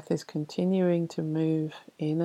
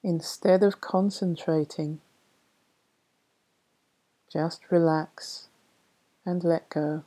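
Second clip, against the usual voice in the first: poem reading. Acted. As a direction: read the verse gently and unhurried. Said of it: "I'll read you a poem".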